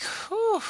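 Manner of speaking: sharp high whistling exhale; high breathy exhale. Retold or imitated. Calming himself outside, a high shaky exhale. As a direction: blow the breath out high and thin. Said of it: "I'm like".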